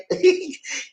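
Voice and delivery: bright burst of laughter high up; real high laugh. Real, not acted. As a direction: laugh at how crazy it is. Laughing at crazy story.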